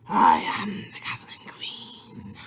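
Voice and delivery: slow, eerie whispered announcement; dramatic whisper. Pretend. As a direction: breathe the title like a secret spell. Repeats title in a whisper.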